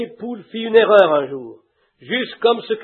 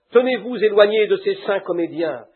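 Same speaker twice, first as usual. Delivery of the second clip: ordinary delivery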